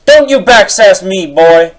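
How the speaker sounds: high, loud furious scolding; angry old man shout. Acted. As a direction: yell down at the kid. Angry boomer retort.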